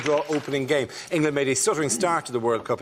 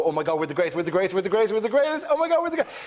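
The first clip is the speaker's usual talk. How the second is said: chanting boast climbing steadily higher into frenzy; hyped-up fans; 4 semitones higher, much faster than usual. Acted. Host mocking English fans before every tournament, building mock frenzy. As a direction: build from usual pitch to high mock hysteria. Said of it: "you do this thing where you go".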